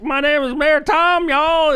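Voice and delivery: hammed-up fake Southern politician; put-on Southern accent. Mayor mocking himself greeting southern voters, fake Southern accent. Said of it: "I'm like".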